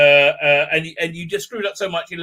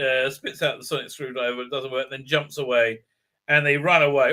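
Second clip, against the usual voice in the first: ordinary delivery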